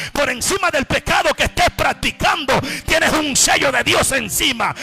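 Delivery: loud, driving shout to the congregation; preaching at full force. Acted. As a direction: pound each line like a fiery preacher. Predicación a gritos. (predicación a todo pulmón, gritando)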